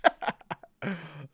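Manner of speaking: high-pitched burst of laughing; high, tickled laugh; real amused laughter. Real, not acted. Cracks up at replayed clip.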